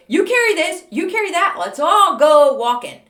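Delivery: high, loud, bossy cheer; bossy cheerful orders. Acted. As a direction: take charge with loud chirpy orders. Bossily handing out items.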